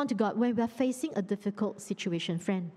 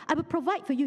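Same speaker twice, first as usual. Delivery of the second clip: usual speaking voice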